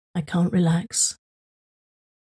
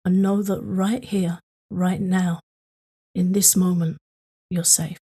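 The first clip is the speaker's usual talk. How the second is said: calm grounding exercise. Acted.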